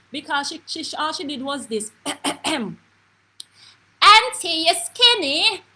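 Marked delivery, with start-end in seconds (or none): 4.0-5.6 very loud, high mimicked child's holler; top of her voice. Grandniece repeating herself to her aunt, hollering at top voice. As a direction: holler it like a little girl at full volume. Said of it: "On the top of her voice".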